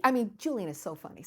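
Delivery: ordinary talk, nothing marked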